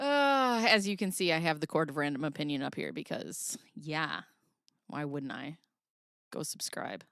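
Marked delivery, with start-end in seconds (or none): 6.3-7.0 whispered aside; hushed plug to subscribe. Real, not acted. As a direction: breathe it to the viewers.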